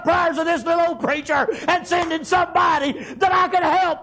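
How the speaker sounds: joyful, laughing prayer of thanks; bubbling over. Retold or imitated. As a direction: pour out grateful joy to the Lord. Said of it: "I was a bubbling over".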